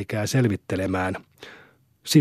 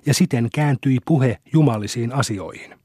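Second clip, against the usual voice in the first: ordinary delivery, nothing marked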